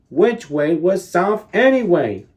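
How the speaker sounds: ordinary delivery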